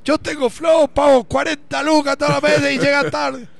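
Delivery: high, loud, indignant complaint; angry rant. Retold or imitated. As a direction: shout your frustration at the cable company. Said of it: "Y pone y dice".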